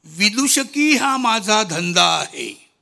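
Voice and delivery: loud, slow declaimed verse; poem recitation. Acted. (कविता वाचन, मोठ्या आवाजात संथपणे)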